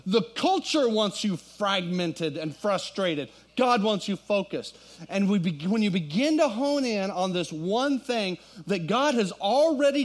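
high, loud, driving sermon climax, slowed on key lines; preaching at full force. Acted. Pastor rallying his church toward one focused word, preaching loud and high. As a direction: build the congregation up with fire.

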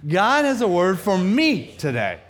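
"me" punched loud and drawn out; slow, loud declaration landing on "me"; stress on me. Shown as a demonstration. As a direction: hit the word "me" hard. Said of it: "emphasize the me".

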